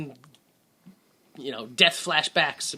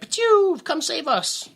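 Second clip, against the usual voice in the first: mock signal noise; 10 semitones higher, 3 dB louder, slower than usual. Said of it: "they're like".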